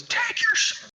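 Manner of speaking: high yelled heckle; mock yell. Woman in the crowd yelling at the pod runner, high mock yell. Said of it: "yelling at the dude".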